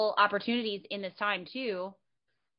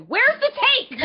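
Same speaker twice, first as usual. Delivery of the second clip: high, loud panicked cry while walking the dog; mock frantic yell; 11 dB louder than usual. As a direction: shout it as if the take just vanished. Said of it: "What the fuck is she screaming?".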